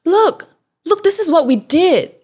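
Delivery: high, excited imitation; eager showing-off. Couple showing traveller their photo, eager imitation.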